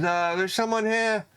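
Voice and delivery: high, slow hesitant call to the master; a shy kid calling his boss; timid apprentice calling. Acted. Apprentice calling the dwarf smith, high and hesitant. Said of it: "He goes".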